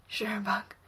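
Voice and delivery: soft, breathy reply; breathed. A narrator's reading. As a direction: let it out on a breath. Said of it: "Steve breathes".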